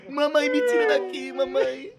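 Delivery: high slow mock sob; mock crying. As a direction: whimper for mommy. Crying out for mom jokingly.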